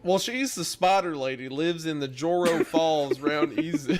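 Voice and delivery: put-on reading in a lightly Southern accent. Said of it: "Your southern accent with this is so good".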